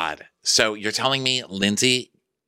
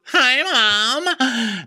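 much higher, louder squeaky Hi Mom; mock Lindsay; 4 dB louder than usual. Lindsay hugging Danielle, mock high squeaky greeting. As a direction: pitch it way up, daughter greeting. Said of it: "And she's like".

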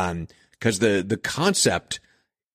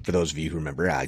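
ordinary talk

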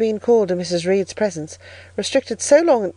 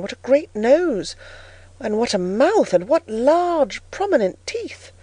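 slow exclamations climbing higher; horrified wonder; 4 semitones higher, slower than usual. A narrator's reading. Young girl facing the tall stranger's huge features, appalled wonder. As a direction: marvel wide-eyed, stretching out each feature. Child marvels at a frightening face.

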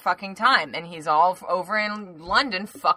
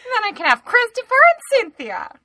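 laughing mock Nunes; 13 semitones higher, 6 dB louder than usual.

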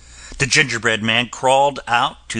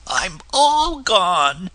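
high, slow little voice; slow and small, fading away; gingerbread man voice; 6 semitones higher, much slower than usual. A narrator's reading. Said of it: "said the gingerbread man".

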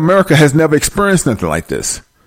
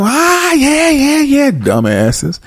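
mocking pastor; 10 semitones higher, much slower than usual.

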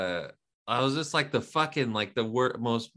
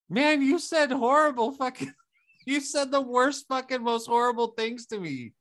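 mock aggrieved complaint; 10 semitones higher than usual. Acting out angry complaint.

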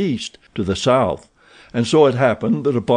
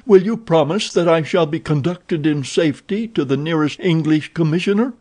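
pleading; 6 semitones higher than usual. A narrator's reading. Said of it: "he pleaded".

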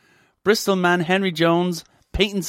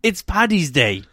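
ordinary talk, nothing marked

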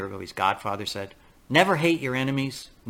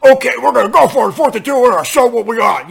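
much higher and louder, fast, pumped-up meathead; mocking jock bravado; 9 semitones higher, 13 dB louder than usual. Fan mocking the jock coach's fourth-down call, loud cocky bravado. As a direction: lampoon a cocky jock hyping a fourth-down gamble. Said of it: "it's the typical jock mentality".

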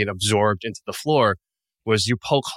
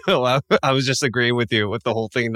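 ordinary talk, nothing marked

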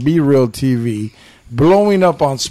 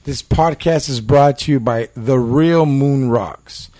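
ordinary talk

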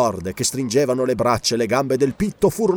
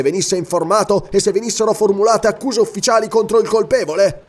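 higher-pitched, indignant protest with contained menace; repressed anger; 7 semitones higher, 3 dB louder than usual. A narrator's reading. King challenges a Roman verdict, repressed anger. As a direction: argue hotly, holding back the rage. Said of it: "l'ira repressa non riusciva a nascondere la minaccia".

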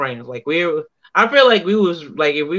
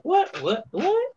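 what rising very high at the end; mock disbelief; 4 dB quieter, slower than usual. Guest imitates everyone's stunned reaction, rising high. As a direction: imitate a crowd's double take. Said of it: "everybody was like".

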